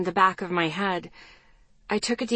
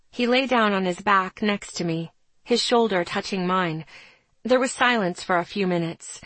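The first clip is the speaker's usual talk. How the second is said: plain reading voice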